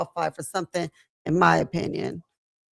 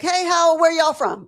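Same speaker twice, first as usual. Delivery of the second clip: imitating a curious Southern local, pitched up; 8 semitones higher, 4 dB louder than usual. Locals quizzing the LA visitors, mock Southern twang. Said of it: "Everyone's already like".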